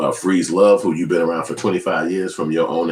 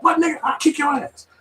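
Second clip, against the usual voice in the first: very high, hammed-up threat; mocking a reverend who loses his temper. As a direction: flip from holy to fighting mad.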